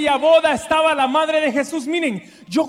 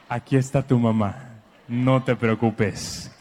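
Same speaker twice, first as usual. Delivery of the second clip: much lower, gentle and slow reassurance; mother's comfort; 12 semitones lower, slower than usual. Retold or imitated. Mother reappearing in the hospital to calm her son, gentle. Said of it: "salía de la nada".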